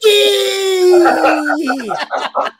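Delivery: big, high burst of laughter; loud laughter. Real, not acted. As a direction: laugh hard right after the shout. Laughing after yelling Scotty.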